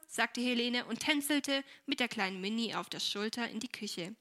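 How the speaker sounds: plain reading voice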